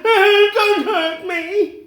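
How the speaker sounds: high, loud, frightened begging; cowardly lion pleading. Acted. The scared lion begging Dorothy's group to spare him, high and loud. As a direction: plead for mercy, scared stiff.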